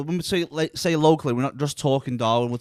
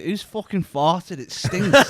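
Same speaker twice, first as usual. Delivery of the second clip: mock outrage; 5 semitones higher, 5 dB louder, much slower than usual. Joking about a smell.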